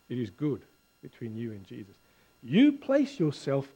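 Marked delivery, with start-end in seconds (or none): 0.1-1.9 low, soft comfort, fading at the end; kindly reassurance. Acted. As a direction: gently settle the listener's guilt. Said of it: "speaking to you kindly".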